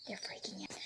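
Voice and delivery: hushed, laughing whisper; a breathless whispered aside. Real, not acted. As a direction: whisper so the animals calm down. Animals upset, hushing.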